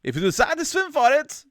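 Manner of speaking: put-on very high, loud German accent; mock German accent. Narrator reading out the swim option, mock German accent. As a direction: squawk it like a hammed-up German.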